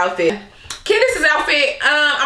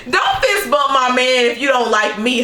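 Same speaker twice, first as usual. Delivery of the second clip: ordinary talk, nothing marked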